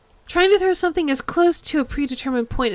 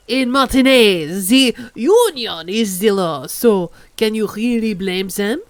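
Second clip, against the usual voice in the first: put-on hammy French accent, proud; over-the-top French gentleman; bad French accent; 3 dB louder than usual. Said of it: "over-the-top bad French accent".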